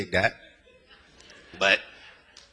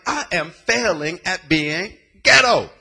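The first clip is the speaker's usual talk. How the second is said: mock exasperation; 13 semitones higher, 13 dB louder than usual. Mocking his younger self.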